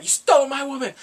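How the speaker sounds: high, loud mock outrage; angry accusation. Man punching his rival, loud retold accusation.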